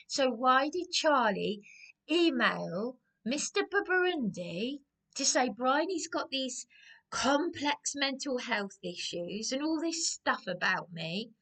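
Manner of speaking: ordinary talk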